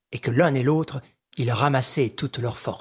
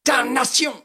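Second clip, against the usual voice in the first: high pained yell; wounded Sholmès yelling, much higher; 12 semitones higher, 4 dB louder than usual. Acted. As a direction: cry out as the arm is shot. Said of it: "hurla Sholmès".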